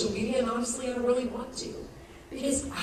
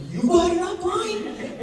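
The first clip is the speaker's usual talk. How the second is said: high, loud incredulous question; can't believe there's another one; astonished you got an upline; amazed; 10 semitones higher, 4 dB louder than usual. Retold or imitated.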